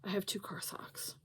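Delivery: whispered aside to the camera. Shown as a demonstration. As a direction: drop to a secret whisper. Repeats line, then whispers it.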